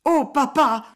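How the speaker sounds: put-on high, loud young woman's cry; emotional cry. A narrator's reading. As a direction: call out to papa, overwhelmed with emotion. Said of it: "s'écria l'apparition avec un accent plein d'émotion".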